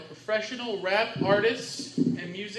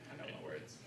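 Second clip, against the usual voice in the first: mumbled; 22 dB quieter, faster than usual. Real, not acted. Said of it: "I didn't quite catch it".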